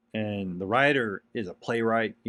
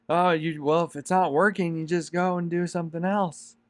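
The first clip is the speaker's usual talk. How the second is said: higher, sing-song imitation of a dismissive naysayer; mocking the doubters; 7 semitones higher than usual. He imitates people who tell him to quit, mocking and higher. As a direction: toss it off like someone who thinks your dream is silly.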